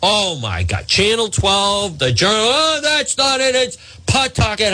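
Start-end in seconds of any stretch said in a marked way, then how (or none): 2.4-4.7 mock fussy correction. Mocking media's fussy correction.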